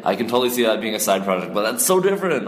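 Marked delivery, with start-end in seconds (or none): 1.5-2.5 stunned disbelief. Real, not acted. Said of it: "Nick is freaking out".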